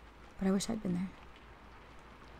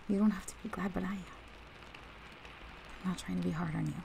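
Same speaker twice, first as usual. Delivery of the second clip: ordinary delivery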